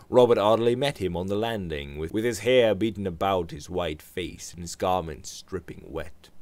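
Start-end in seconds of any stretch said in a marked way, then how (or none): none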